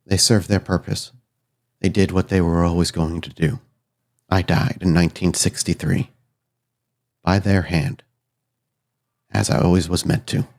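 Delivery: subdued, measured voice with long pauses; resigned, solemn spirit. Acted. Spirit recounts its death to the newcomer, solemn and resigned. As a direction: tell it gravely, accepting your fate.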